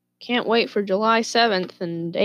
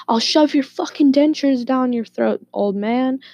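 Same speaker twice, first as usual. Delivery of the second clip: mildly heated, higher-pitched threat; a touch of retold menace, pitched up; imitated angry threat; 6 semitones higher than usual. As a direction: play an uncle threatening the neighbour. Uncle threatens the neighbour.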